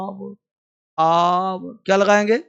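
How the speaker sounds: normal talking voice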